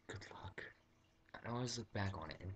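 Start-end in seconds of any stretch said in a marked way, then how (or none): 0.1-0.6 mock whisper. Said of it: "the guy looks at me".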